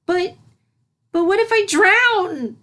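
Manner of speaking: high, slow, stammering filly voice; plead, terrified of the water; frightened filly. A narrator's reading. Filly backing away from the pool, terrified.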